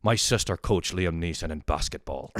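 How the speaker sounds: Northern Irish caller impression; imitated Northern Irish accent. Caller revealing a family link to the actor, Northern Irish accent. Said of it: "And he's like".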